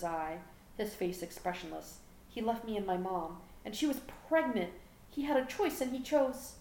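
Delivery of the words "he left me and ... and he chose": rising to a higher, upset pitch; hurt teenager close to breaking. A narrator's reading. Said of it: "His voice broke".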